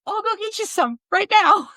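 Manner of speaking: high panicky fan promise; flustered eager promise. Retold or imitated. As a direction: scramble to fix it, squeaking. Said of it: "I'm like".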